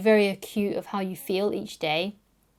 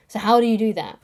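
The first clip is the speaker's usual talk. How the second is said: ordinary talk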